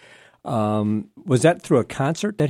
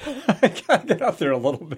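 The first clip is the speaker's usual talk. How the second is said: higher, laughing parroting of the line; laughing mock echo; 9 semitones higher than usual. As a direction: repeat it back through a laugh, teasing. Host echoes guest's line laughing.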